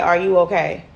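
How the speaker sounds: ordinary talk, nothing marked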